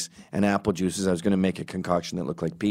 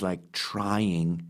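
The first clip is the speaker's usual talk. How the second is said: usual speaking voice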